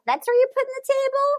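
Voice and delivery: high, quick baffled question; mock-stunned question at the odd table; baffled disbelief. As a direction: sound truly puzzled by it.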